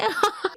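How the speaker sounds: high eerie cackle in the night; mock demonic giggle; creepy laugh. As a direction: cackle like someone possessed. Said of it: "empieza a reírse".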